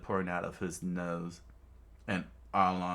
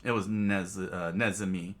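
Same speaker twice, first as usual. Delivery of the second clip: ordinary delivery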